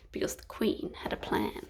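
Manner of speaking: soft conspiratorial whisper; whispered suspense. A narrator's reading. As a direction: hush it like a secret.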